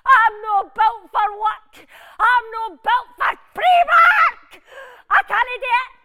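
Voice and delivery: shouted tearful despair, building to a high wail; hysterical meltdown. Acted. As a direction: sob it out in full melodramatic panic. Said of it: "Calm down".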